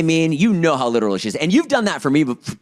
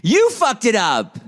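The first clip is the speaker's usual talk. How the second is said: high, drawn-out accusation; accusing; 8 semitones higher, slower than usual. Real, not acted. Co-host blaming his friend for the ruined surprise, accusing. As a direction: throw the blame straight back, slow and high. Co-hosts argue over who's to blame.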